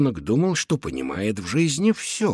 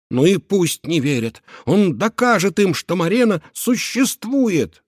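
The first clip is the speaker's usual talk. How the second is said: tearful defiance; 7 semitones higher, 5 dB louder than usual. Acted. Crying, defiant inner vow.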